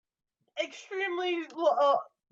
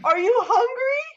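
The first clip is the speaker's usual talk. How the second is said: called out high and loud, half laughing; high, loud caring question; 8 semitones higher, 5 dB louder than usual. Acted. Player calls to Eli, high and loud. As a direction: pitch it up and call it out.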